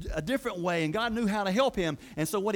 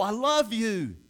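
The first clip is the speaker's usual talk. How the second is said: raised, heartfelt cry; from the soul; 4 semitones higher, 4 dB louder, much slower than usual. Acted. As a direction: declare it with your whole soul. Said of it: "From his, from his soul".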